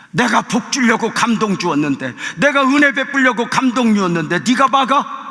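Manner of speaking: loud, high-pitched divine rebuke; preacher acting out an indignant scolding; God scolding. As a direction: thunder it out as God telling someone off. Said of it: "하나님이 혼내는 거야".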